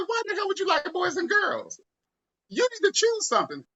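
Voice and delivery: mom's voice pushed way up, indignant; shrill outrage at her son's news; outraged high-pitched mom. Retold or imitated. Mother scolding her son, high and outraged.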